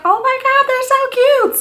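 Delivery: very high squealing delight; squealing teacher. Retold or imitated. Vlogger imitating teachers squealing over candle gifts. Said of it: "they're gonna be like".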